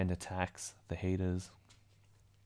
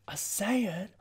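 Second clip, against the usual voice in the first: much higher, startled question; shocked; 11 semitones higher, 4 dB louder than usual. Retold or imitated. Young Granola spots a tail, high and startled. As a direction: gasp out the word in alarm. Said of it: "He's like".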